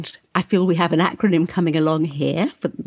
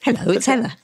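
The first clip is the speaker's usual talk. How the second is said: bright, high, friendly hello; grin in voice; 6 semitones higher, much faster than usual. Shown as a demonstration. Host gives her phone greeting, smiling. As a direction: let a smile lift the greeting. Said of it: "I hear the little grin in your voice when you say it".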